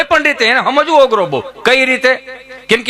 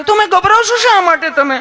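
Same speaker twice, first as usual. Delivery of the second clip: much higher, gently coaxing; sweet high nurse; 10 semitones higher than usual. Retold or imitated. Nurse coaxes nervous Gabho in a high sweet voice. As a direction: soothe a nervous patient. Nurse asks why he's afraid.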